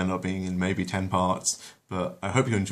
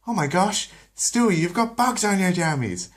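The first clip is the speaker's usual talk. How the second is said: much higher, shrill-free motherly alarm; 12 semitones higher, 4 dB louder than usual. Acted. Lois finds fleas on her baby, high alarm. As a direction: gasp at the bugs on your baby.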